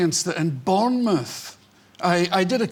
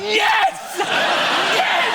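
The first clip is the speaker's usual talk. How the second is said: very high, loud cheer; triumphant cheer; 5 dB louder than usual. Retold or imitated. Boy thrilled at the comedian's swearing, triumphant cheer. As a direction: let out a shrill, delighted shout. Retold boy's triumphant cry after being sworn at.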